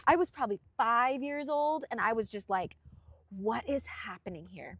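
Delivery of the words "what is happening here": soft, breathy whisper of disbelief; hushed bewilderment. Retold or imitated. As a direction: whisper as your younger, baffled self. Retells childhood hushed bewilderment.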